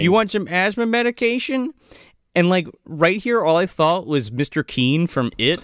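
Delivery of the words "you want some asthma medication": higher, wary shopkeeper voice; a suspicious pharmacist catching a kid; imitating the pharmacist. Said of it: "the pharmacist".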